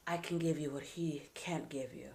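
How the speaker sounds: seductive unvoiced whisper; whisper in the ear. Cheating lover whispers temptation into an ear. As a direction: breathe it into someone's ear. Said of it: "This one is the whisper in the ear".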